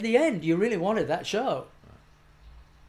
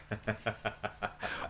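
real laugh; 6 semitones lower, 10 dB quieter than usual. Real, not acted. Laughs at his own punchline.

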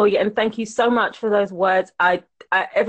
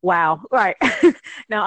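normal talking voice

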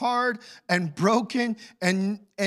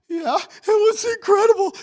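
much higher, louder, crying voice; father breaking into tears; 13 semitones higher, 8 dB louder than usual. Retold or imitated. Father answering his son while crying. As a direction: let the voice crack into a sob. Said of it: "he starts crying".

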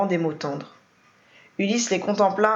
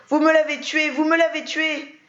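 nervous breakdown shriek; hysterical fit; 9 semitones higher than usual. A narrator's reading. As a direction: scream it while shaking her. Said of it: "elle faisait une crise de nerfs".

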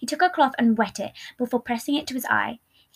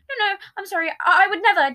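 high, louder pleading denial; desperate; 9 semitones higher, 5 dB louder than usual. A narrator's reading. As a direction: stammer out a desperate apology. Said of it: "Deceit desperately answered".

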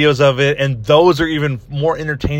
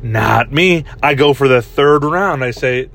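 ordinary talk

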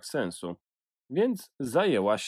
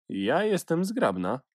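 a lightly cool, higher cut-in; cool interjection; 7 semitones higher than usual. A narrator's reading. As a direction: cut in with mild coolness. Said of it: "wtrąciła szumiąc chłodno".

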